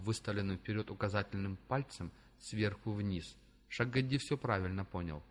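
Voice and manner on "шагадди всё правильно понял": ordinary delivery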